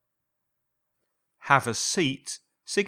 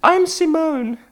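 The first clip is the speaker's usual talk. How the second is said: put-on much higher, louder young woman greeting the detective; high voice of a nervous rich woman; woman's voice; 10 dB louder than usual. A narrator's reading. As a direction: play the client walking into the office. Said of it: "she said".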